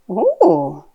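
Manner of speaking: animated, slow picture-book reading with big swoops; reading to a toddler. Shown as a demonstration. Mum sharing a picture book with her little one, animated child-directed reading. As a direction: draw out the words, playful and full of wonder.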